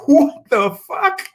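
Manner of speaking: slow, high, stunned what the fuck; shocked outburst. Retold or imitated. To his barber greeting him with a slur, slow stunned shock. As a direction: drag it out in disbelief.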